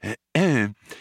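short, polite throat-clear; polite cough. A narrator's reading. Old man about to correct visitor, polite cough. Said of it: "Slartibartfast coughed politely".

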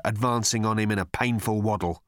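ordinary delivery

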